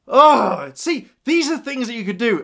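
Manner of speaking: loud groan of delight, then high gushing; excited exclamation. Real, not acted. Fan carried away by story possibilities, excited.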